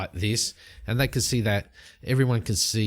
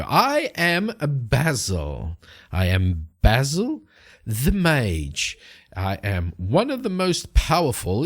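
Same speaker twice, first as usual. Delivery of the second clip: grand, slow, theatrical proclamation; pompous self-announcing wizard; boastful mage; slower than usual. Acted. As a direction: declaim it with big swoops of pitch and weighty pauses. Mage grandly boasts of his powers.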